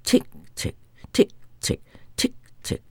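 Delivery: whispered watch ticks; fading whispered tick tick; soft ticking. Acted.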